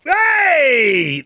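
a high, loud hammed-up yelp of mock outrage; mock offended. Host yelps in mock offence at the caller's "good for your age". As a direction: pretend to be stung by the compliment. Backhanded compliment about his age.

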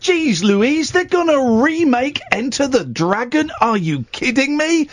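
high, incredulous outrage; outraged disbelief. Acted. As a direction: react like it's a personal insult. Outraged rant about remake.

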